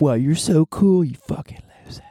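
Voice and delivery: sarcastic fake awe; mocking sarcasm. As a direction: gush it high, then drop to a whispered jab. Mocking people who show off with slang.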